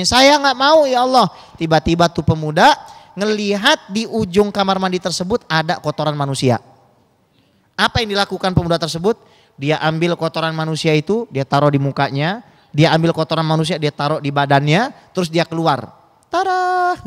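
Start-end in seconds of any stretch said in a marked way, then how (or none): 0.0-1.3 raised, higher-pitched plea to God; pleading outcry. Acted. Young man praying in the bathroom, pleading outcry. 16.3-17.0 high, held-out "ta-da" reveal; comic ta-da. Acted. Young man steps out of the bathroom, comic ta-da. Mock reveal after smearing himself.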